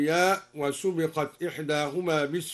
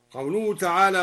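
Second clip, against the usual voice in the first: usual speaking voice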